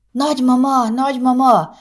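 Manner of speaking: put-on high, eager cry of a small boy; excited child calling. A narrator's reading. Little boy calling his grandmother, eager and high. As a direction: tug at grandma and call her.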